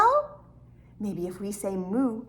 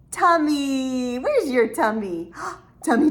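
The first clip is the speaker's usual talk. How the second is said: ordinary talk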